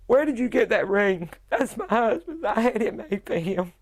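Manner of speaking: retold alarm breaking through the question; panicked pleading. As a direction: demand it again as panic rises.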